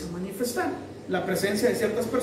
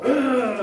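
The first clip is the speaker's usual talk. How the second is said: short louder growl of rage; angry growl; 5 dB louder than usual. Shown as a demonstration. Preacher showing what rage sounds like, growling. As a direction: snarl it out like an angry dog. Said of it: "Rabia ya es cuando".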